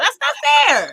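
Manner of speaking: childish mock complaint; protesting child. Woman voicing her childhood self barred from playing with a friend, childish protest. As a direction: protest like somebody's little daughter. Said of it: "You sound like my daughter".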